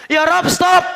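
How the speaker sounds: high, loud cry to halt; protesting crowd. Acted. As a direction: shout it up to God like a protester. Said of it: "Rakyat rame-rame demo sama Allah". (teriakan protes bernada tinggi)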